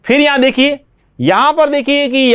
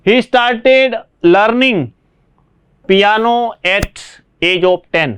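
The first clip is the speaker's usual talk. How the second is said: slow dictation; much slower than usual. Shown as a demonstration. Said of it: "dictating the sentence".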